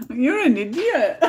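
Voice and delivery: laughing out the insult, pitch up; laughing too hard to be mean. Real, not acted. Laughing at Brian's mix-up, calling him an idiot through laughter.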